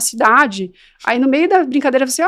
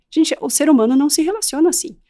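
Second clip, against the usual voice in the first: usual speaking voice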